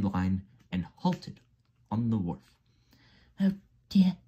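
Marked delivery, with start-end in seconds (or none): 3.4-4.2 high, dismayed little engine; Percy's groan. A narrator's reading. Percy thinks the race is over, groaning. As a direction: moan it in a high character voice. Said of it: "groaned Percy".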